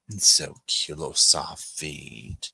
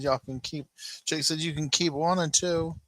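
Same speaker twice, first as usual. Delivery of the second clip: high pretend dog voice, much higher; dog's voice; 10 semitones higher than usual. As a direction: speak for the puppy in a high playful voice. Voicing the dog's reply.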